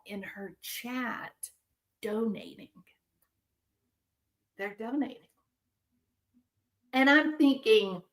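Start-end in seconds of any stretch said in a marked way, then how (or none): 0.0-5.2 whispered, breathless disbelief; hushed disbelief. Real, not acted. Vlogger whispering in disbelief about chat donations, hushed. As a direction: breathe the words out in shocked whisper. Shocked that viewers keep donating.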